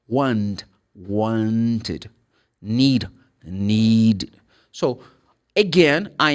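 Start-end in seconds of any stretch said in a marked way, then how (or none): 0.1-2.1 "Want", then "wanted" with an added -id syllable; ID ending demo. Shown as a demonstration. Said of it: "produce the sound of id". 2.6-4.4 "Need", then "needed" with an added -id syllable; ID ending demo. Shown as a demonstration. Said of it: "produce the sound of id".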